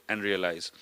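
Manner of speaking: usual speaking voice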